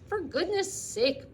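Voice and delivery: high, loud, slow exasperated bellow; drenched farmer bellowing at his hired man. Acted. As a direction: roar it out, soaking wet. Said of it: "bellowed Mister Zuckerman".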